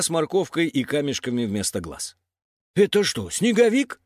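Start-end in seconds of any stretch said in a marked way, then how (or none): 2.7-4.0 high-pitched puzzled question; bewildered. A narrator's reading. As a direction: ask as if you can't believe it. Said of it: "растерянно спросил Трак". (растерянно)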